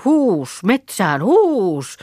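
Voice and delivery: high, drawn-out cattle call; herding call. Acted. Girl driving lazy cows into the forest, herding call. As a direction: shoo the herd along with a high cry.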